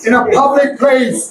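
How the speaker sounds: "public place" drawn out slowly; slow British model. Shown as a demonstration. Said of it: "আবার পড়ো". (ধীরে British ঢঙে "public place")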